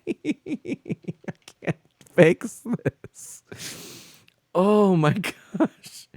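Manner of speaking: high, slow, breaking into laughter; laughing to tears. Real, not acted. Streamer telling chat the game froze, laughing to tears, high and slow. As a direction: laugh until you tear up. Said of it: "I'm crying a little bit".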